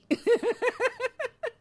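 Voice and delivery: high teasing giggle, falling away; high laugh. Acted. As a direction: let a high mocking laugh spill out. Laugh after the jab.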